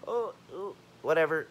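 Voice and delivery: high, dismissive mimicry; mock reaction. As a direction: mimic them brushing you off.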